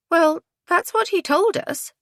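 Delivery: high-pitched indignant protest; affronted woman's high voice. A narrator's reading. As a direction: defend yourself, voice lifted high. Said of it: "said Lizzie indignantly".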